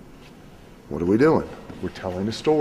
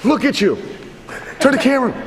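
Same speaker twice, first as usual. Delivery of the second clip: raised commands; 7 semitones higher than usual. Real, not acted. Coach calling his two wrestlers to their feet, raised commanding voice. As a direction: bark the order to stand up.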